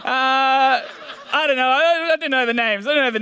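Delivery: laughing through it, embarrassed. Real, not acted. Preacher laughing at forgetting the actor's name. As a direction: chuckle as you fumble the names.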